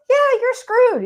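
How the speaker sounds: high, loud imitation of the friend; friend's blunt verdict. Guest imitating her Texan friend on grief, high and loud. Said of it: "And he goes".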